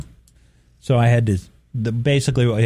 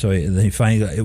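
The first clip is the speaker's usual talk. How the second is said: normal talking voice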